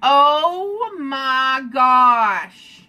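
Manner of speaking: long drawn-out dismay; very slow, appalled groan of words. Real, not acted. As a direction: stretch every word out in disbelief. Dismayed at the makeup he does.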